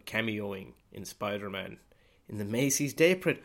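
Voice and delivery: normal talking voice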